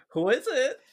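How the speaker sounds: high, loud call through a door. Pretend. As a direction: shout it at the closed door. Shouting through a sealed door.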